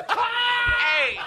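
high shout. Acted. Calling out in comic bit.

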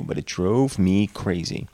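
usual speaking voice

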